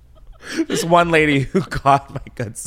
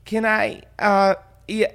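imitating a tipsy friend stalling, higher pitch; mock drunk phone fumble; lightly voiced tongue-tied caller; wasted friend freezing up; 8 semitones higher, slower than usual. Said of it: "She freezes up 'cause she's wasted".

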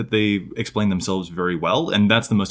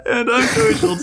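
laughing; 12 semitones higher, slower than usual. Real, not acted. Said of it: "that really broke me".

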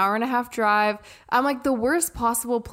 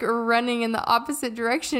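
ordinary talk

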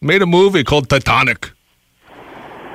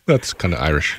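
Irish; 13 semitones lower, 6 dB quieter than usual. Acted. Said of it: "It sounded like a leprechaun".

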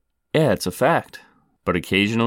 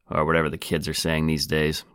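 ordinary delivery

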